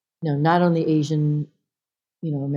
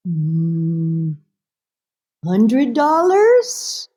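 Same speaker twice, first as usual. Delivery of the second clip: hesitant mother; 4 dB louder, much slower than usual. Retold or imitated. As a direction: venture a guess, stretching it upward. Mother guesses the cost.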